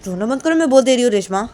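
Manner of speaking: loud, high-pitched order; exasperated shouted scolding; angry warning. Acted. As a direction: warn her firmly at full voice. Angrily ordering friend to stop crying.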